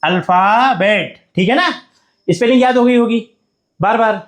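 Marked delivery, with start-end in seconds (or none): 0.0-1.2 letters spelled very slowly, then the word; teacher dictating spelling. Shown as a demonstration. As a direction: dictate it slowly so students can write. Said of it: "उसको बार बार repeat करेंगे".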